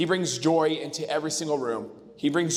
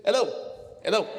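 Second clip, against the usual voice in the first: put-on light, higher German-accented hello; German accent greeting; 6 semitones higher, faster than usual. Toast guest imitating the German goalie coach, light German accent. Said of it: "his little German voice".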